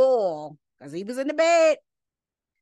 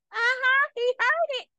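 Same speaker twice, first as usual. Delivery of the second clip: high-pitched, knowing aside; gleeful confirmation; 12 semitones higher than usual. Real, not acted. Preacher confirming the prayer was heard, gleeful. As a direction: pop up high, savoring it.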